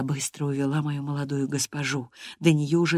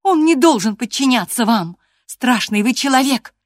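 high, loud accusation; defiant cry; 8 semitones higher, 8 dB louder than usual. A narrator's reading. Catherine accuses the master in front of Gerton, crying out. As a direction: cry it out at him. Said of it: "крикнула Кэтрин".

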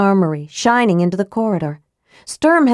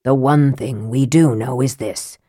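low, measured mage; lightly voiced mage making a point, pitched low; Raistlin; 5 semitones lower than usual. A narrator's reading.

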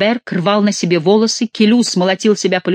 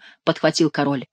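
usual speaking voice

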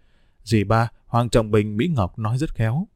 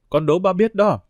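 put-on a child's sudden, higher, eager blurt; blurting out loud; 6 semitones higher than usual. A narrator's reading. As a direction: blurt it out to tease dad. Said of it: "Bích Vân đã vọt tiếng nói lớn".

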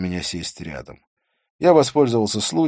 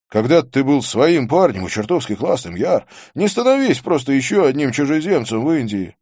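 raised, higher character voice; Vikram; 7 semitones higher, 6 dB louder than usual. Acted. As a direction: cut in and press the point, pitched up.

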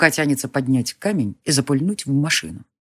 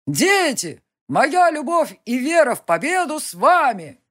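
high, fervent address; rousing leader; 9 semitones higher, slower than usual. Acted. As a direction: bless the crowd from the stage.

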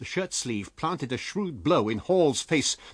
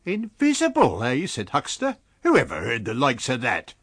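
incredulous; 7 dB louder than usual. Acted. Huxter scoffs.

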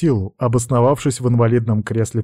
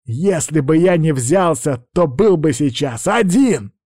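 higher, biting retort; snapping back; 6 semitones higher than usual. A narrator's reading. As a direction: snap back at him. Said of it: "огрызнулся дракон".

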